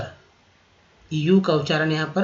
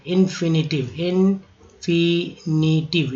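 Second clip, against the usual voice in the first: slow spelled-out drill; slower than usual. Shown as a demonstration. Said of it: "तो याद रखिएगा टिव".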